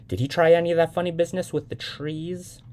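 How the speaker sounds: pry nervously like a mother; probing mother. A narrator's reading.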